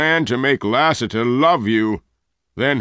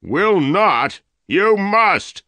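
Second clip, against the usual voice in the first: much higher, louder shout; shouted demand; 12 semitones higher, 5 dB louder, much slower than usual. Acted. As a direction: bark the order at her. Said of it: "Dyer shouted".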